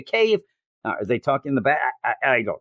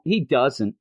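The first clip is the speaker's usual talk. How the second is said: usual speaking voice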